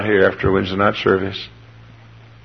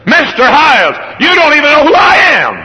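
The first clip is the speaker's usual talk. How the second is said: bossy little princess; 8 dB louder than usual. Retold or imitated. Indignant girl scolding pastor.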